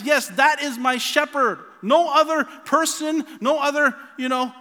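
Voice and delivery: loud, high, eager sheep voice; voicing sheep who hear their shepherd, calling out loud and high. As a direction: cry out like a sheep that knows its shepherd. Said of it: "And they recognize".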